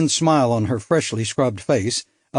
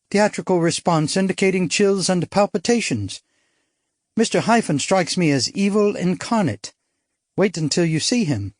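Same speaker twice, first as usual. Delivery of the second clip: put-on lighter, higher bright young woman; spirited society girl, higher; young woman character; 6 semitones higher than usual. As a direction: play her as a bold upper-class girl. Narrator voices young woman.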